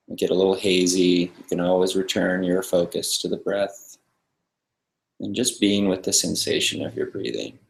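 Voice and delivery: soft, calm meditation guide with long pauses; guided meditation. Real, not acted. Meditation teacher settling a group into the breath, calm guiding voice. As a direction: ease them into stillness, leaving silence between phrases.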